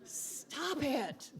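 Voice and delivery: high-pitched mock reprimand at normal volume; comic scolding. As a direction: cut in with a playful high "stop it".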